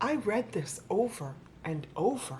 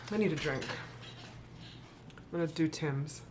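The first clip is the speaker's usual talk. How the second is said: ordinary talk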